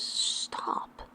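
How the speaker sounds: soft unvoiced whisper. A narrator's reading. Alice whispering to the Cheshire Cat. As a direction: hush him secretly. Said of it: "said Alice".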